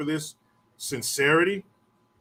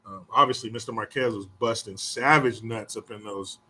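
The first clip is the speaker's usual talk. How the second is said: ordinary talk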